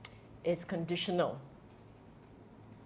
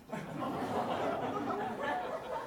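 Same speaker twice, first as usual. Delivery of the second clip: breathless laugh; 10 semitones higher, 12 dB quieter than usual. Real, not acted. Laughs after joke about Pali.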